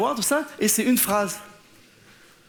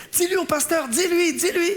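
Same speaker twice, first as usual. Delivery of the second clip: insistent mother; 7 semitones higher than usual. Retold or imitated. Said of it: "elle dit".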